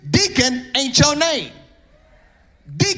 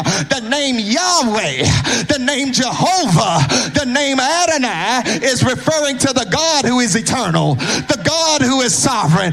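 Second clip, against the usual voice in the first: ordinary talk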